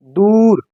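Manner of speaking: दूर with a full, open ऊ; open long oo. Shown as a demonstration. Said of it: "वाव पर जोर देते हैं हम". (दूर में ऊ को खुल कर पढ़ें)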